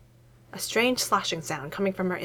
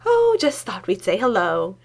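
forced easygoing; 4 dB louder, slower than usual. A narrator's reading. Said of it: "an attempt at an easygoing laugh".

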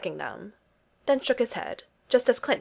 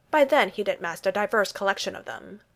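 usual speaking voice

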